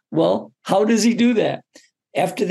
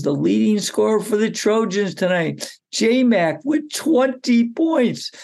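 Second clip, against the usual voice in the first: high, drawn-out arena announcer call; public address announcer; 6 semitones higher, slower than usual. Retold or imitated. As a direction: announce the top scorer, stretching out the name. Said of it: "public address announcer comes on and says".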